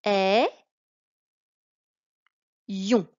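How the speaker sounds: "Ay-" loud, pause, then "yon"; split into syllables. Shown as a demonstration. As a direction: break "Ay-" from "yon" with a clear pause. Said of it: "la décomposition avec les deux i".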